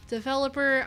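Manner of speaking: ordinary talk, nothing marked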